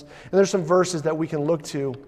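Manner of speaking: ordinary talk, nothing marked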